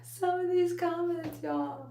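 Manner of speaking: high, slow laughing disbelief; amused laughing. Real, not acted. Streamer reacting to viewers' cheeky comments, laughing.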